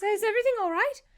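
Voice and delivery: anxious, hurried check; worried urgency. Acted. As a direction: rush it out with rising concern. Jin worriedly rushes over.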